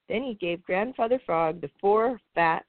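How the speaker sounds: plain reading voice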